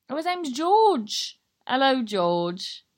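loud, drawn-out hello to a fan; excited greeting. Real, not acted. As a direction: greet him warmly and loudly, stretching the words. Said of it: "excited greeting".

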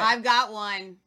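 loud and eager; excited. Real, not acted. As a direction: show off the find. Excitedly found the clip.

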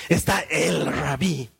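"el" drawn out slowly; weighty, stretched "el" before "rabí"; stress on EL. Real, not acted. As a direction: lean long and slow on "el". Contrast with just any rabbi.

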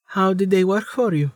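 lightly voiced young woman. Amelia voiced by narrator.

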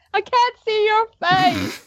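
slow, high-pitched mock American accent; hammed-up American "can't", high and slow. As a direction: draw it out in a teasing, put-on American twang. Jokingly repeats own complaint, put-on voice.

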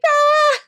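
high, loud "ah!" shriek; mock startled scream. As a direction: let out a sudden high-pitched yell, re-enacting shock. Retold startled reaction to psychic.

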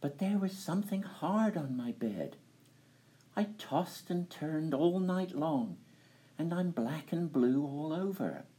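high, dainty princess voice; squeaky little princess complaining; cartoon princess. A narrator's reading. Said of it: "said the princess".

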